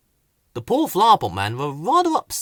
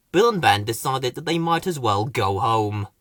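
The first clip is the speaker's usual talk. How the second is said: ordinary delivery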